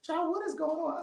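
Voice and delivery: high, soft voicing of a baffled friend; a friend whispering what's going on; Mike puzzled. Friend Mike listens in on the call and asks in a high hush. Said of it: "So Mike is like".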